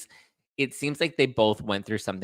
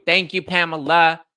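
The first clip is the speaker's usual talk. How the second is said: sarcastic thanks; 6 semitones higher, 7 dB louder than usual. Real, not acted.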